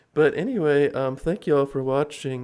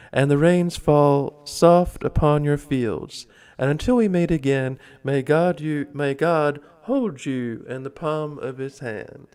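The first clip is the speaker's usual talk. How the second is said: reciting a blessing; 4 dB louder than usual. Acted. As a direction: read it like a toast to the crowd. Reads an Irish blessing aloud.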